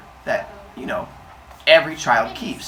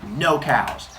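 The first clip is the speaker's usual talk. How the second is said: usual speaking voice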